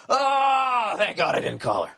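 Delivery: sudden high cry of relief, then dropping back; relieved exclamation. Pretend. Mock relief, a man imagining life after not texting his ex. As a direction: blurt out relief like a man who dodged a bullet. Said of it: "you're gonna be like".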